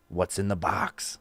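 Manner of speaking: quiet, drawn-out question; hushed game intro. Acted. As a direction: softly set up the mystery. Host introduces the game's catchphrase.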